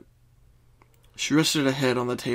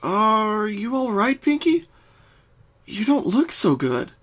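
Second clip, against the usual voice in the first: higher, slow and worried; concerned Twilight; 9 semitones higher, slower than usual. Acted. Twilight worried for her distressed friend, slow and higher. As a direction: ask gently, slowing right down. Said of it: "noticing the distressed look".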